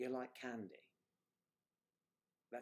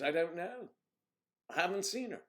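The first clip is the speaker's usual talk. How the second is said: quoting the other actor; 8 semitones higher, 5 dB louder than usual. Retold or imitated. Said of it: "He says".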